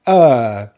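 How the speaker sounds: long "er" with no R; long vowel demo. Shown as a demonstration. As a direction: hold "er" with the jaw dropped a little. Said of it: "where we drop the jaw a little bit".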